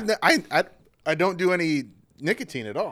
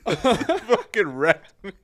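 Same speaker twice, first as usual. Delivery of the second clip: laughing; 6 semitones higher, 4 dB louder, much slower than usual. Real, not acted. Laughing fit.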